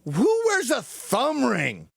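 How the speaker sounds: high, slow mockery; mocking. Mocking the young man's thumb ring in front of his date. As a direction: ridicule him, drawn out.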